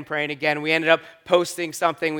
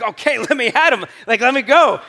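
retold eager fight; 6 semitones higher, 5 dB louder than usual. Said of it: "I was like".

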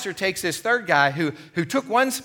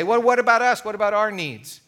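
whiny complaint; 5 semitones higher, 4 dB louder than usual. Acted. People whining about fairness.

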